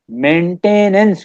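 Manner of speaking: मेंटेनेंस drawn out slowly as main-TAY-nance; syllable-by-syllable spelling aid. Shown as a demonstration. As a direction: stretch the middle "tay" of मेंटेनेंस. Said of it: "कर लें आप इसको".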